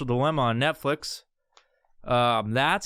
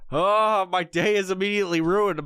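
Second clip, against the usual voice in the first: ordinary talk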